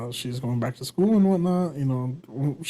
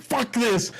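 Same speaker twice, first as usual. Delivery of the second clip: higher-pitched mock outburst; pissed-off kid; 7 semitones higher than usual. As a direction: imitate a kid who has had enough. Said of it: "I'm just pissed off".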